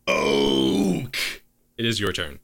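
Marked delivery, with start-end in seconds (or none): 0.1-1.2 half orc bellows a name, low and loud; half orc roars after his fallen ally; orc yells a name. Acted. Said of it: "And he yells out".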